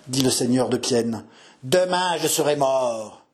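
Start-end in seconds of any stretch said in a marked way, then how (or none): 1.6-3.3 slow, drawn-out dying voice; despairing old man. Acted. Dying lord answering the suitor's promise, despairing old man's voice. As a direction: pronounce your own doom.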